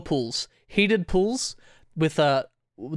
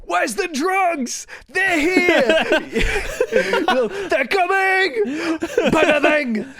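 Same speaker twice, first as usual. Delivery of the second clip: high, loud fake panic, cracking into laughs; panicked prank scream; 13 semitones higher, 5 dB louder, much slower than usual. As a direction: scream it like a frantic prank caller who can't keep a straight face. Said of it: "we scream like".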